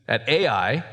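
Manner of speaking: ordinary talk, nothing marked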